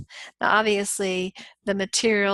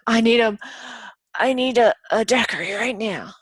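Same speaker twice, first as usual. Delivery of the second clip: desperate plea; 2 semitones higher, 7 dB louder than usual. Retold or imitated. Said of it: "I go".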